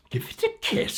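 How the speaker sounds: high, outraged echo; shocked echo. Acted. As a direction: squeal it back in disbelief. Disgusted queen repeats request.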